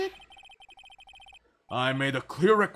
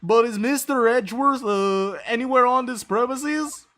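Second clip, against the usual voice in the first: higher, louder, eager rookie cop; a nervous beat cop bursting in to report; goofy cop voice; 10 semitones higher, 9 dB louder than usual. Acted. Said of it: "Let me try and find a voice for him".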